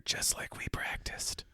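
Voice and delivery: slow, hushed whisper; very soft, drawn-out secret whisper. Acted. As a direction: whisper confidentially into an ear. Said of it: "I whisper in its ear".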